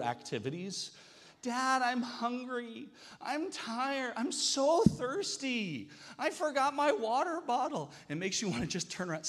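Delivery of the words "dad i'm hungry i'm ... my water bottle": put-on whiny little kid. Young son whining to his dad on a hike. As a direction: whine like a tired little boy.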